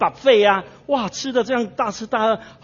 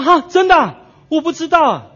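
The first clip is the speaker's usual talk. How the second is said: high, loud burst of disbelief; astonished; 9 semitones higher, 6 dB louder than usual. Acted. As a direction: gasp it out, amazed the food was free. Man learns food is free.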